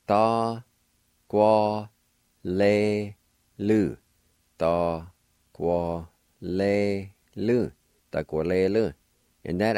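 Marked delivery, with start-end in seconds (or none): 0.1-4.0 "Da, gua, le lu" sounded out one syllable at a time; Cherokee word, syllable by syllable. Shown as a demonstration. Teacher sounding out a Cherokee word for learners, syllable by syllable. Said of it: "pronounced like this". 4.6-7.7 "Ta kwa le lu" drawn out very slowly; slow demo. Shown as a demonstration. Teacher slowly modelling the Cherokee word for car. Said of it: "pronounced like this".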